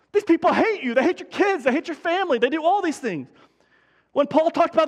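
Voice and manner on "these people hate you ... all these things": higher, driving preacher's outrage; impassioned pulpit protest; rising indignation. Acted. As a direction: push the pitch up, pound each hate. Preacher voicing indignant listener.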